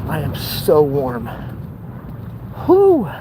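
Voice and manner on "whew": high puffed-out whew; hot whew. Real, not acted. Vlogger roasting in the sun, high relieved whew. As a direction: blow out the heat. Complaining about the heat.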